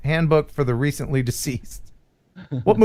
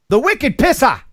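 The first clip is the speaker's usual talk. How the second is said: put-on high, punched Boston accent; attempted Boston accent; 7 semitones higher, 4 dB louder than usual. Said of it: "I can't do a Boston accent".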